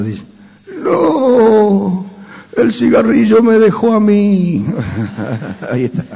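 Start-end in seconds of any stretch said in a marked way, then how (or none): 0.8-4.7 voice from beyond the grave. Acted. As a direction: ghostly wail from the grave. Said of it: "la voz de ultratumba del payo".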